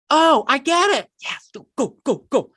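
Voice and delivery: high, eager eureka cheer; excited realization. Retold or imitated. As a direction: cheer them on as it clicks. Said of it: "the light bulbs come on in your mind to go".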